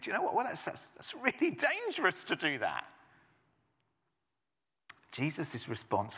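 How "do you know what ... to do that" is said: higher lightly voiced cautious grown-up; a hint of a prim worrier; fussy adult. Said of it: "we can come adults and think".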